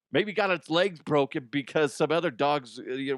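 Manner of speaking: ordinary talk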